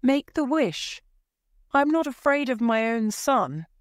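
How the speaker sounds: high, urgent insistence; crying out. A narrator's reading. Wife insisting husband make the wish, crying out. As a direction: demand it passionately, pitched high.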